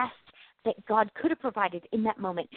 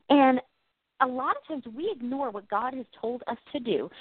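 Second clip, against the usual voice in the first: ordinary talk, nothing marked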